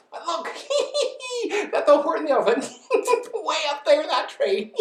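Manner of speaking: high mocking laughing shout. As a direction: jeer through laughter. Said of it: "they shouted with glee".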